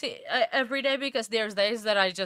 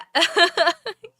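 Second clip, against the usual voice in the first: high giggle; 7 semitones higher, 7 dB louder than usual. Real, not acted. Laughing at chat comments.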